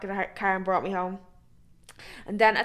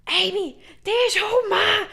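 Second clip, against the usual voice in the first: high, loud, slow yelp of a warning; panicked warning; 10 semitones higher, 5 dB louder, much slower than usual. Retold or imitated. Young fella warning Amy her ma has arrived, high alarmed yelp. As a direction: shout it as he bolts.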